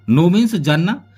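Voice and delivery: normal talking voice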